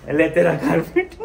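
loud words through a laugh. Real, not acted. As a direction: laugh while handing it over. Returns carpet while laughing. (हँसते हुए ज़ोर से)

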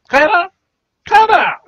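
calling her name loudly and high; shouting a name. Acted. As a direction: yell for Kyra across the house. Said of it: "Why are you shouting?".

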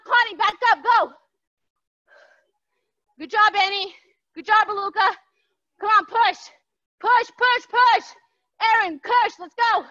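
loud coaching calls; aerobics instructor. Real, not acted. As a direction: pump up the class. Fitness coach shouting encouragement.

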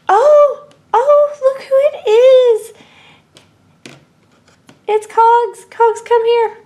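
thrilled high-pitched toy character calling its robot friend; high excited toy voice. Pretend. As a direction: gasp and squeal with joy at a friend arriving. Toy figure greets robot Cogs.

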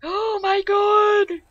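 gasping shriek of fright, high and loud; terrified gasp. Real, not acted. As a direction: jolt back in real fright. Jump scare by monster.

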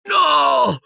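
very high, anguished moan; an old wizard groans in pain; groaning cry. A narrator's reading. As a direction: cry out no in agony. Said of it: "gemette il mago".

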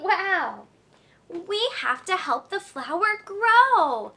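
ordinary talk, nothing marked

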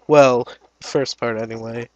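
ordinary talk

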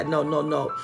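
ordinary talk

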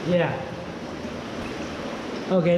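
ordinary talk

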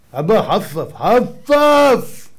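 very slow, high, drawn-out "chill"; dismissive teen. Retold or imitated. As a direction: brush dad off like a lazy teen. Teen brushing off father.

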